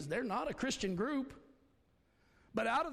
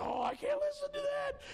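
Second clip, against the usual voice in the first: mocking whine. Pastor mocks a squeamish believer refusing a song, high mock squeal. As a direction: parody a prissy churchgoer, pitched way up. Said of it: "I'm not gonna go".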